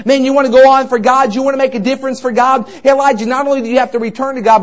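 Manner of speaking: ordinary talk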